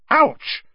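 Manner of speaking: sudden high, loud yelp; sharp cry of pain. Acted. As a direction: yelp as the pus stings. Pus burns Hermione's hands.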